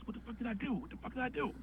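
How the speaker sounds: quiet freak-out; hushed panic. Retold or imitated. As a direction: panic softly under your breath. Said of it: "I'm like".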